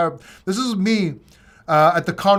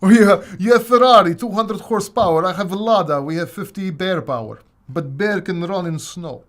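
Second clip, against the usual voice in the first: mock Russian accent; 6 dB louder than usual. Mock Russian comparing Lada to Ferrari for viewers. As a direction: brag about the Lada like a proud Russian. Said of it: "making fun of the Russian as well".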